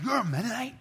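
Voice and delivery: high, loud, delighted question; light up at the news; thrilled Korean pastor, amazed; excited. Retold or imitated. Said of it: "he was excited".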